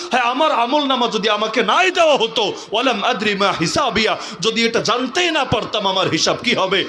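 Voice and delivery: high, anguished preacher's wail; crying out in regret. Acted. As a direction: cry it out like a condemned soul. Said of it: "বান্দা চিৎকার করে বলবে".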